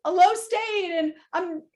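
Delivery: to her therapist, a high whiny lament; whiny distressed self. Retold or imitated. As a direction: plead like a lost child. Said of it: "I went in and I was like".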